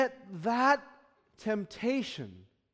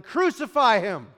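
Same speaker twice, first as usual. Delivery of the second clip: loud imitated mob cry; crowd yelling; 3 semitones higher, 8 dB louder than usual. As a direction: shout it like the angry crowd. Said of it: "many of these people will be yelling".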